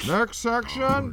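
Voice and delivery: high, loud, slow announcer call. Pretend. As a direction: stretch it out like an announcer. Announcing the next segment.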